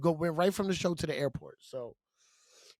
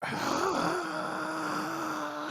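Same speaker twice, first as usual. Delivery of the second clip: long high, soft crowd roar; imitated crowd eruption; 11 semitones higher, 5 dB quieter than usual. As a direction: mimic a whole crowd roaring, held long. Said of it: "eruption from the crowd".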